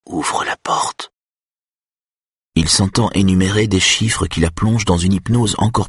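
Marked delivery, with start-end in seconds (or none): 0.1-1.1 slow, whispered mumble; muttering. A narrator's reading. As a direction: mutter it slowly under your breath. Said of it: "et marmonne".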